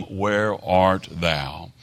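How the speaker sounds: drawn out very slow, each word weighed; slow solemn question. Acted. Preacher reading God's call to Adam, very slow and solemn. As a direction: let the question hang, very slow.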